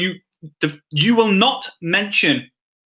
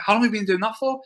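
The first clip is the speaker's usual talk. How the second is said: higher-pitched follow-up question, lightly acted; interested questioner; 6 semitones higher, faster than usual. Coach models following up on a prospect's job, interested questioner. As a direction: sound curious about their work. Acting out a getting-to-know-you chat.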